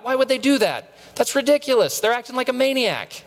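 high, scornful inner voice; mock judgment. Judging others in our head, high scornful scoff. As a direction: sneer at other people's behavior.

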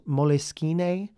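"Moleskine" drawn out as mo-leh-skee-nay; exotic pronunciation variant. Shown as a demonstration. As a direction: say "Moleskine" slowly in four syllables, ending in rising NAY. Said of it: "heard it pronounced all sorts of different ways".

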